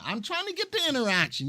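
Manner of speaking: a hint of mock pleading; pushing for interaction. Host on livestream, eagerly pushing viewers to interact. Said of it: "You see, I'm pushing".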